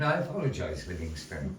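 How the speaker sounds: ordinary delivery, nothing marked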